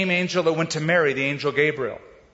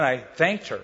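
ordinary delivery

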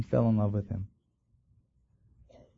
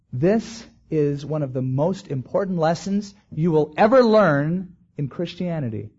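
ordinary talk

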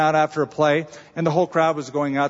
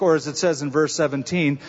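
ordinary talk, nothing marked

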